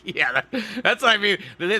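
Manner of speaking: usual speaking voice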